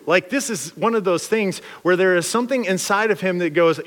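ordinary talk, nothing marked

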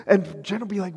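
usual speaking voice

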